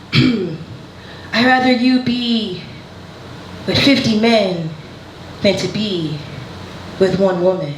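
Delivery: put-on slow, deliberate Haitian-accented mother. Comedian as conservative Haitian mother disapproving, heavy Haitian accent. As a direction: put on a rough Haitian accent, pausing between phrases. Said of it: "I'm gonna do the accent".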